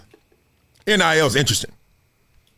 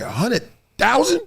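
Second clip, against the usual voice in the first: shocked question; 7 semitones higher than usual. Retold or imitated. Shocked at the sum.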